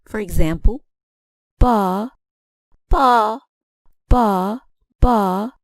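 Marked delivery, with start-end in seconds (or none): 1.6-5.5 "ba, ba, ba, ba" with the second one higher-pitched; spaced syllables, raise the pitch on the second "ba"; stress by pitch. Shown as a demonstration. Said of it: "used a higher pitch for the second".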